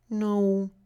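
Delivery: high, timid little turtle voice; small turtle Franklin. A narrator's reading. Franklin asks the duck for help, high and timid. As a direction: ask like a shy child. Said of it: "said Franklin".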